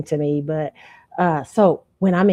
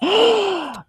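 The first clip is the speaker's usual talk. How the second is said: high, sharp mock gasp; imitated gasp; 3 dB louder than usual. Imitating the audience after her play, a high gasp. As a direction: gasp like an amazed crowd. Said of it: "people were like".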